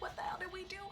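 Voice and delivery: frantic whisper of disbelief; whispered panic. Retold or imitated. Scared wife whispering in the car to her husband. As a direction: hiss it out in shock.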